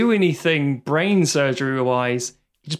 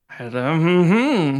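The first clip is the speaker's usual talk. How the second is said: slow, drawn-out odd humming; stretched mock hum in a put-on voice; imitated film noise; 3 dB louder, much slower than usual. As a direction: mimic a strange drawn-out hum. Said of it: "what the fuck is this noise".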